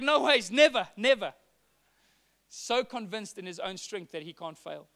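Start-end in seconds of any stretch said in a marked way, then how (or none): 0.0-1.3 loud, insistent denial, higher at the start; emphatic refusal. Acted. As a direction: fiercely swear you'll never leave. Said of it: "And he's like".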